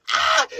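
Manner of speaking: very high re-enacted shriek; mock scream. Screaming back at the girls, high mock shriek. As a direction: scream back in falsetto, volume held back. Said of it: "And then I was like".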